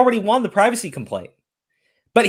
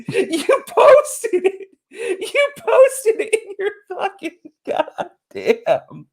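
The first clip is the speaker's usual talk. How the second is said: much higher, slow, words broken by laughter; laughing too hard to finish; 10 semitones higher, much slower than usual. Real, not acted. Mocking the streamer to his guest, laughing helplessly.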